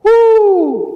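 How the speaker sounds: a sudden high, loud whoop of delight; joyful high whoop. Real, not acted. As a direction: cry out with joy. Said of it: "I love that".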